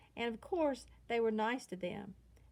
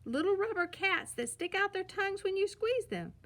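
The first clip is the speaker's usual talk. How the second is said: put-on much higher eager kid voice; excited cubs; 9 semitones higher than usual. A narrator's reading. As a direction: rattle off the toy like a thrilled child. Cubs excited about toys.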